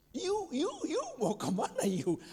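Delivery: high-pitched mock village slang; mock village youth. Teacher mocking villagers who use English words, high mocking voice. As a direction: parody a villager showing off English pronouns. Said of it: "di desa ngomongnya".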